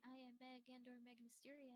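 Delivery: barely audible, far from the mic; very faint, hushed introduction; quiet voice. Real, not acted. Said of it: "You're really quiet".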